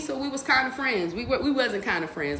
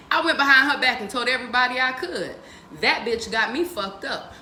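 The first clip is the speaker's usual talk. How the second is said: usual speaking voice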